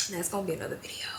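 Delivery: breathy whisper, quickening on the repeat; whispered aside. Real, not acted. Shaken by her own words, she whispers an aside.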